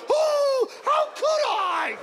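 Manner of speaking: high, wailing dog in anguish; hammed-up horrified self-blame; guilt-stricken dog. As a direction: howl with exaggerated remorse, very high. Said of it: "Dogs feel bad when they kill".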